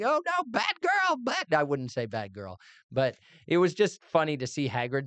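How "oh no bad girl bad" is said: high, slow mock scolding; playful high-pitched scold; scolding parent. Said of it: "and I'm going".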